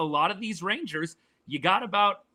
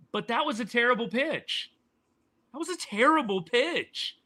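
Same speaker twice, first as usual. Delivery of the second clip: exasperated emphasis; 7 semitones higher than usual. Real, not acted. Outraged analyst repeats his verdict.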